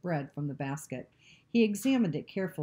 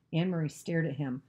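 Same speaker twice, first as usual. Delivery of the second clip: plain reading voice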